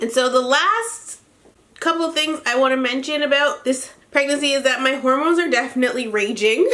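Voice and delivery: ordinary delivery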